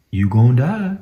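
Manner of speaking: loud, menacing mock threat; hammed-up villain threatening the soldier; growled mock threat before a stab; menacing movie line. Voicing the German's stab.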